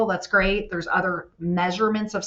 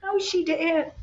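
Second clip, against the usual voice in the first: sassy; 9 semitones higher, slower than usual. Retold or imitated. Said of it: "I was like".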